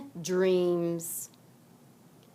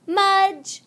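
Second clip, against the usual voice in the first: high, loud fond cry; laughing exclamation; 12 semitones higher, 6 dB louder than usual. Acted. Henry catching Mudge with the cookie, laughing. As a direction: call his name through a laugh. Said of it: "Henry laughed".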